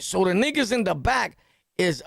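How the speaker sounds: ordinary delivery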